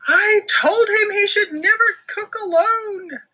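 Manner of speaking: high loud crying wail; high fearful sob; worried wail. Acted. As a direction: wail it in fear. Character fears Max fell in.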